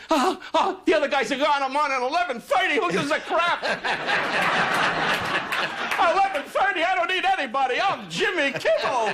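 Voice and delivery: high, far-off, cocky mock voice of a host full of himself; mocking Jimmy. Don mocks Jimmy's ego to his face in a high smug voice. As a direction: put on a smug, squeaky voice to make fun of him. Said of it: "you were too busy saying".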